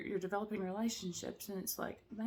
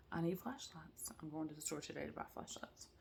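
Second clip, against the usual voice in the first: soft, breathy, fast whisper to the camera; hushed, hurried note to self; whispered aside; 2 semitones lower, 12 dB quieter than usual. Real, not acted. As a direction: whisper it like a secret confession. Aside after storm fear.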